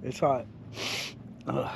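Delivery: talking with a mouth on fire from hot jerk. Real, not acted. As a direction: mouth burning from spicy food. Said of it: "My mouth is on fire".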